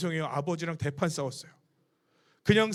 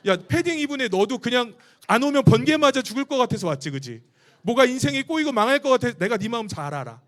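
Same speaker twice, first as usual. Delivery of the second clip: loud, fervent preacher calling to the crowd; full-force sermon voice; preaching at full force; 4 semitones higher, 9 dB louder than usual. Acted. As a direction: preach it out to the back row. Calls out to the congregation.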